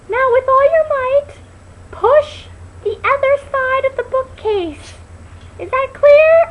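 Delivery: squeaky high pained doctor, slow and loud; really high voice. Retold or imitated. Doctor caught in the bookcase speaks in a very high voice. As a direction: squeeze it out very high and loud, hurt. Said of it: "this really high voice".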